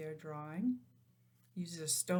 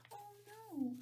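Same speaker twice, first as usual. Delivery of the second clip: soft high gasp, then oh no; gasp of dismay; 13 semitones higher, 6 dB quieter than usual. Acted. Reading to children, gasping at the sad news.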